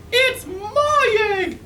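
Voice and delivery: very high, slow, loud bird squawk; sputtering Maisie. A narrator's reading. Maisie demands her egg back from Horton, high slow squawk. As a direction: squawk it out indignantly. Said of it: "she sputtered".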